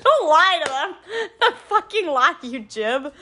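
high, loud through real laughter; laughing scold. Real, not acted. Teasing her co-host for lying, laughing. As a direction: scold her while cracking up.

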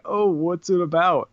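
high, mocking put-on voice; mocking quote. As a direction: mimic a lazy critic, mockingly. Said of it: "it's a tired take to be like".

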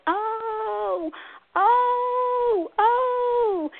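high, long-held yelled "oh"; playful yelling. Pretend. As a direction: call out each round "oh" at length. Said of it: "like we're yelling".

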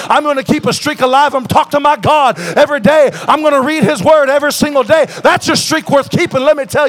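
loud rising sermon declaration; roaring revival preaching; full-force preaching. Real, not acted. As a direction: preach the new streak like a battle cry.

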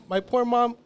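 usual speaking voice

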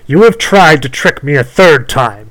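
high, loud, cruel witch voice. Acted. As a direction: snarl it like a wicked witch. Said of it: "she said cruelly".

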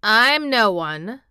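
put-on loud, slow old gardener; dry country wit. A narrator's reading. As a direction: make it a slow, dry retort. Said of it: "said old Ben dryly".

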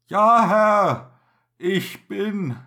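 loud, high answer; shouted reply. Acted. Helmsman answering through smoke.

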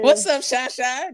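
high, drawn-out teasing voice; sing-song tease of an absent girl; mock greeting. Friend teasing, greets her in a high mock voice.

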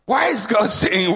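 ordinary talk

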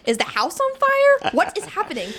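high, startled alarm; jolted awake, what's going on. Retold or imitated. Retold alarm at dad shouting.

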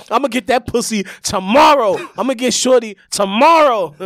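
high and loud, cocky certainty; swaggering boast. Shown as a demonstration. Showing a friend how to sound confident, loud cocky boast. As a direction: brag it like it's guaranteed. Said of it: "That's how you go".

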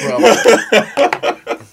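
slow words through laughter; laughing. Real, not acted. Laughing while cohost laughs.

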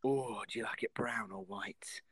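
slow, soft whispered question; creepy whisper. Retold or imitated. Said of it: "what is he gonna talk about with other people".

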